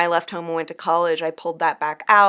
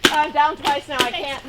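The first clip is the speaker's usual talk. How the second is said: man down shout; 7 semitones higher than usual. Real, not acted. Downed in game.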